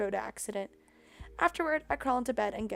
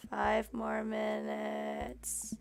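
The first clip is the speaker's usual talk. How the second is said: groggy plea; 7 dB quieter, much slower than usual. Acted.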